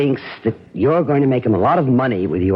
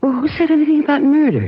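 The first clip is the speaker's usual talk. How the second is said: feigned innocent surprise; faster than usual. Acted. Woman feigning surprise to her lover, high quick protest. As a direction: pretend the idea never crossed her mind. She feigns shock at murder.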